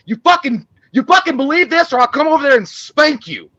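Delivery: loud, high ranting sermon voice; hammed-up preachy shouting at the audience; mock preachy yell. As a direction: bark it like a preachy scold. Mocking a preachy movie.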